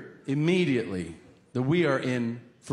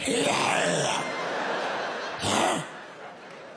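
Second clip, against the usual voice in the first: breathless gasping laugh. Real, not acted. Laughing so hard he can't breathe.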